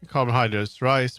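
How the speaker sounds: ordinary delivery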